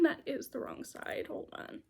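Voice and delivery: under-the-breath whisper to herself; whispered aside. Real, not acted. Fumbling aside while showing socks.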